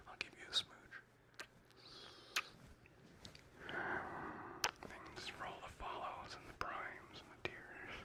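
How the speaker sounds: very soft whispered thank-yous; ASMR whisper. Real, not acted. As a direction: breathe the thanks close into the mic.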